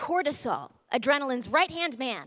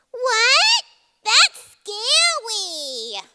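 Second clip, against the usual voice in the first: high, loud, slow squeal of alarm; a tiny brain part panicking; scared amygdala; 11 semitones higher, 9 dB louder, much slower than usual. Acted. The amygdala hearing a scary thought, squealing high in alarm. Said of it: "hears me think that and goes".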